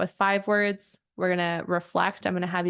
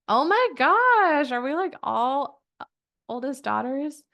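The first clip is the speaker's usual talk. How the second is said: surprised delight; 6 semitones higher than usual. Real, not acted. Coach gasps at her members in surprise. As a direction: gush at the crowd in the chat. Realizes many are oldest daughters.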